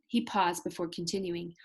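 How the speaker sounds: ordinary delivery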